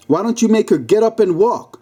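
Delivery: bystander's heckling call; shouting. Acted. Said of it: "a bystander shouted".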